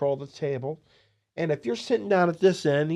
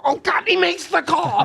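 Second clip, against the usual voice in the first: much higher, louder burst of disbelief; losing control; 4 dB louder than usual. Retold or imitated. A dealer losing his composure at the table, high excited outburst. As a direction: yelp it out as the call comes in. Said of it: "And I lost control".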